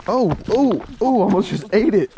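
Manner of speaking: high startled yelps while slipping. Real, not acted. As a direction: gasp out as your foot slips. Nearly falls mid-sentence.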